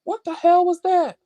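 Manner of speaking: high, startled outcry; real startled alarm. Real, not acted. Startled by noise while recording.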